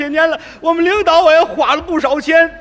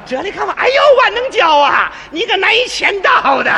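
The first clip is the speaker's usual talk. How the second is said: higher-pitched teasing exclamation; mock neighbour girl; 7 semitones higher than usual. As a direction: lightly voice her surprised scolding. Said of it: "什么口吻呢？". (略带调侃的姑娘腔)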